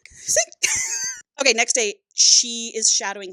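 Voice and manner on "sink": real squealing laugh, very high and loud; shrieking laugh. Real, not acted.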